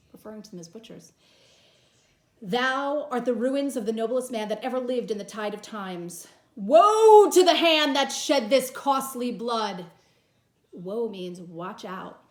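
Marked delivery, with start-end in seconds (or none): 2.4-9.9 dramatic reading. Acted. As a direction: mourn over the body, full voice. Teacher reads Antony's speech dramatically.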